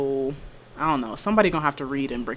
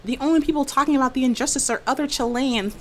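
high-pitched mock protest; put-on high complainer voice; mimicking complainers; 9 semitones higher than usual. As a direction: imitate an indignant online complaint, pitched up high. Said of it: "It was like".

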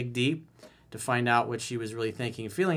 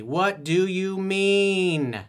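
"What do you mean?" drawn out slowly, higher and louder; slow emphatic question; 7 semitones higher, 6 dB louder, much slower than usual. Shown as a demonstration. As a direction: stretch each word of "What do you mean?" out like a lesson. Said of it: "How to communicate with women effectively".

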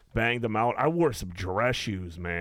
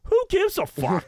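much higher, louder mock outrage; high incredulous scoff; mock dismissive yell; 5 dB louder than usual. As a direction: squeak it out like who cares. Same dismissive reply repeated.

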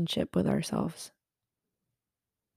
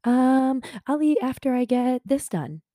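higher, louder, brushing it off lightly; breezy excuse voice; 7 semitones higher, 4 dB louder than usual. Retold or imitated. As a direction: play down your hunger with a bright, higher excuse. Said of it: "just being like".

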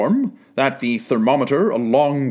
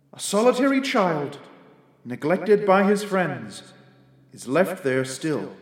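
softer, higher, musing spirit; quiet ghostly spirit; 3 semitones higher, 4 dB quieter than usual. A narrator's reading. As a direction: observe it gently like a ghost. Ghost's lines in the tale.

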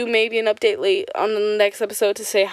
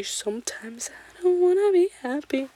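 whisper; 7 semitones higher, 4 dB quieter than usual. Real, not acted. Sudden whispered aside.

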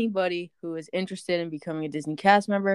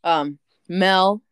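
ordinary talk, nothing marked

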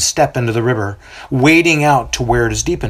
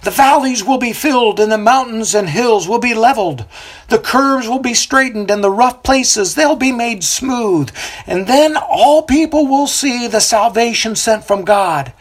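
prophet proclaiming; 11 semitones higher than usual. A narrator's reading. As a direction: preach over the river to the crowd.

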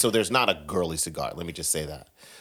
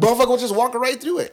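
excited; 8 dB louder, faster than usual. Retold or imitated. Excited retelling of tear gas.

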